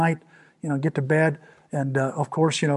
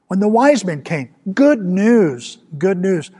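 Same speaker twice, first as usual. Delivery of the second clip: loud, raised proclamation; ringing pulpit exclamation, higher and louder; exultant preaching; 5 semitones higher, 7 dB louder, slower than usual. Acted. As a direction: proclaim it like glad tidings. Preacher exults over Mary's good news.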